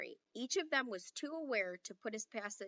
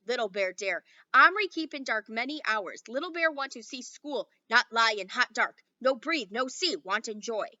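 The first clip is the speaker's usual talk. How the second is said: louder, clipped broken English; defiant little warrior; 10 dB louder than usual. A narrator's reading. Little Bear defending himself to Omri, loud and defiant. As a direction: stand your ground.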